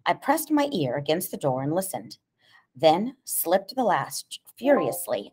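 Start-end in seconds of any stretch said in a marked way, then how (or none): none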